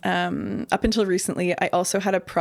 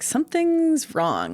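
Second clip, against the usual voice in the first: higher, slow, drawn-out quote; retold unease; 10 semitones higher, slower than usual. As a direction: voice a dawning suspicion, high and slow. Retelling her unease on plane.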